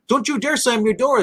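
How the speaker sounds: mock scolding. Quoting the mayor scolding.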